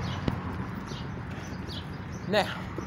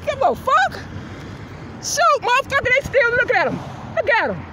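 reliving the shouting; 12 semitones higher than usual. Retold or imitated. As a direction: scream it out like he's still there. Reliving the confrontation.

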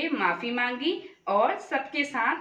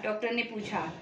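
ordinary delivery, nothing marked

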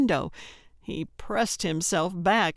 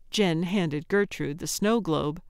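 ordinary delivery

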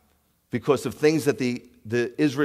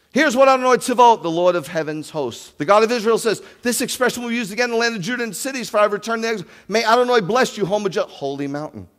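higher, much louder proclaiming read; preaching scripture; 9 semitones higher, 7 dB louder than usual. Acted. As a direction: declare God's word to the hall. Reads scripture with force.

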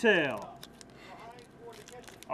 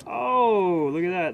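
higher, much louder thrilled exclamation; excited; 4 semitones higher, 10 dB louder than usual. Real, not acted. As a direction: gasp over the card in real delight. Big card pulled.

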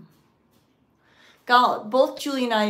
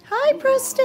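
high, loud coo; 8 dB louder than usual. Real, not acted. Woman greeting her cat Custard in a high baby voice. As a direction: sing the cat's name up high.

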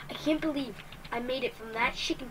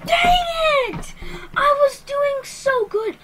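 high, loud cry of frustration; frustrated outburst; 13 semitones higher, 9 dB louder, much slower than usual. Real, not acted. Player killed just before winning, loudly frustrated. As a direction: shout it high, then stretch the complaint.

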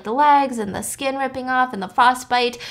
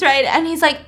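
normal talking voice

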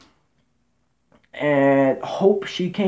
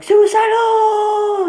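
hammed-up drawn-out yell, much higher and louder, slow; mock dramatic cry; 7 dB louder, much slower than usual. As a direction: stretch it out as a melodramatic screaming fall. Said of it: "she was like".

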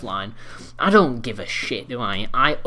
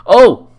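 short, loud high-pitched yelp; sudden sharp shout; startled yelp; 8 semitones higher, 16 dB louder than usual. Real, not acted. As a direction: cry out sharply in surprise. Reacting to a shot.

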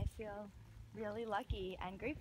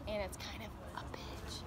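whispered aside; 3 semitones lower, 6 dB quieter than usual. Real, not acted.

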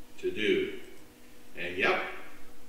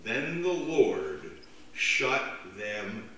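slow solemn reading; 2 semitones lower, much slower than usual. Real, not acted. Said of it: "The words".

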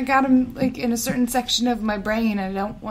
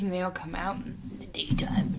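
whispering; 4 semitones lower, 8 dB quieter than usual. Pretend. Spooky trolls pitch, whispered.

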